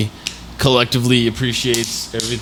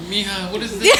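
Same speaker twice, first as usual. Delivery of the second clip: much higher mock grandchild voice with a laugh; mock grandkid; 10 semitones higher, 3 dB quieter than usual. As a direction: play a laughing little grandkid. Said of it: "your grandkids will be like".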